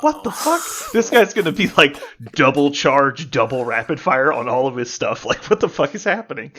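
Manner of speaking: retold disbelief, high-pitched outburst easing then rising; incredulous shock. Players reacting to an overhyped announcement, incredulous. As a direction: replay a stunned, excited reaction. Retelling group's reaction to hype.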